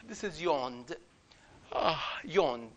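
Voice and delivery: ordinary delivery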